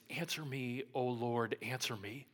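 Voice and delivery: soft, earnest plea to God; hushed pleading prayer; quiet prayer. Retold or imitated. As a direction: pray it low and pleading. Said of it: "Elijah prays".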